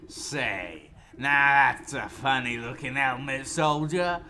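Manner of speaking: loud mocking drunken warlord; bear voice. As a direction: boom it out with a sneer. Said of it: "I got the voice for the bear".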